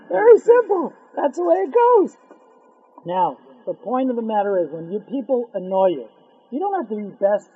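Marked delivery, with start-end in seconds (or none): 0.1-2.2 much higher, louder, driving it home; emphatic repeat. Shown as a demonstration. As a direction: hammer the point. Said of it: "Very simple, right?".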